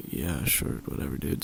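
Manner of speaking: barely bother saying it; mumbled whatever. Retold or imitated. Half-asleep student brushing off the teacher, mumbled softly.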